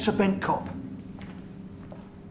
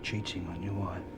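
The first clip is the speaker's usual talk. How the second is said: quiet menace; 3 semitones lower, 9 dB quieter than usual. Acted. Interrogation, voice drops.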